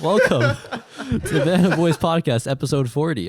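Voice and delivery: loud, slow hosting voice; podcast intro. Pretend. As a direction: announce it like a big show.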